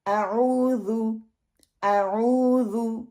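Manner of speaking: slow आऊज़ु with long OO, short final U; correct recitation. Shown as a demonstration. Teacher giving the right आऊज़ु after wrong ones, slowly. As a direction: draw out the middle, end आऊज़ु on a pure U. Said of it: "ये wrong हैं".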